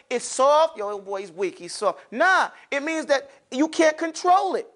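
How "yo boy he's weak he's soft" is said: low, softer aside mocking someone as weak; mock street talk. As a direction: imitate a guy casually dismissing someone. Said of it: "It doesn't mean, like".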